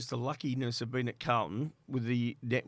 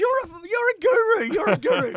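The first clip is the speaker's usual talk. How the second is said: hammed-up praise; 8 dB louder than usual. [name] praising his agent on the phone, hammed-up and high. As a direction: gush like an awestruck fan.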